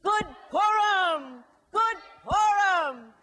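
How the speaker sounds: slow ringing holiday shout; loud joyful greeting. Shown as a demonstration. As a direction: boom it out like a holiday cheer. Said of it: "Let's try it together".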